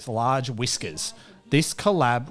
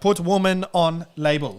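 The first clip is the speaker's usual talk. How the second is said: mock German accent, broken English; clipped German-sounding delivery; 4 semitones higher, 3 dB louder than usual. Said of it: "Is that your German accent?".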